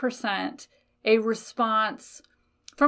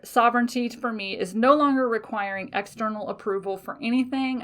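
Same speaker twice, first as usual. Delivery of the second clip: ordinary talk, nothing marked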